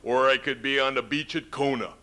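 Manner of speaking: swagger through it like the wrestler governor; imitating Jesse Ventura. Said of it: "I think he said".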